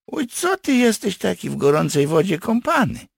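high, loud mild reproach; indignant. Acted. Partisan scolds his hot-headed friend, indignant. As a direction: bristle at a hasty friend, pitched high. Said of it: "obruszył się Jakub".

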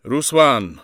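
loud, high call of a name; calling his name. Acted. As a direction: call out firmly to your son. Father calls his son's name.

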